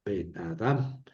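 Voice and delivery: "Ben Adam" run together softly and low, accent on the last syllable; low, quiet "Ben Adam" as one word, only "Adam" stressed; one single accent. Shown as a demonstration. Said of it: "un solo acento".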